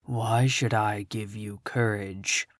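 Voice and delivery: deep, soft, slow wizard's challenge; low demanding Oz. A narrator's reading. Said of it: "demanded Oz".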